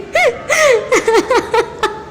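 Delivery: a long high giggle; high laughing fit. Real, not acted. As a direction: let the laugh run out. Laughing at own story.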